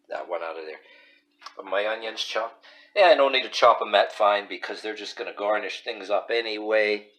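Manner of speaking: ordinary talk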